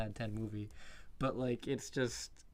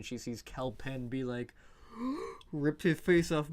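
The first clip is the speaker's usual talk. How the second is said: usual speaking voice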